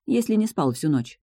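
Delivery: ordinary delivery, nothing marked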